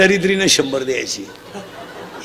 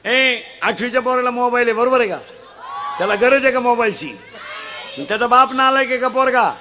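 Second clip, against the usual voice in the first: full-force preaching; 6 semitones higher than usual. Acted. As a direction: drive it home to the crowd. Quizzing the crowd loudly.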